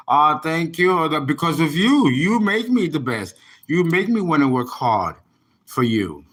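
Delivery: ordinary talk, nothing marked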